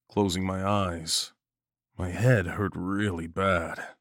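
ordinary delivery, nothing marked

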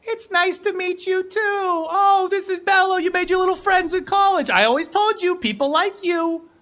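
very high, sweet mom voice; doting flamingo mom. Acted. Mom greeting son's friends, high and sweet. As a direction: coo as the proud mom.

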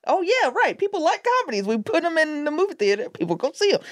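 high-pitched dawning realization, easing down; voiced as an exaggerated "oh, right" moment; mock realization. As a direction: act out remembering something obvious. Voicing a sudden obvious realization.

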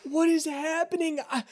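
high, fast hurt babble; tearful plea. Acted. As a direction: blurt out the confession. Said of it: "Richie's like".

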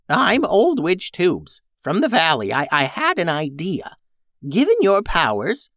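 a lightly voiced old witch. Tubes the old witch speaks.

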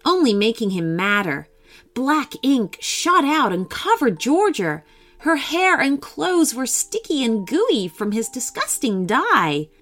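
usual speaking voice

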